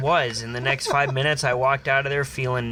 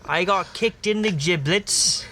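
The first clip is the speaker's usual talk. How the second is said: mock Aussie patient; 6 semitones higher than usual. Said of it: "And I'm like".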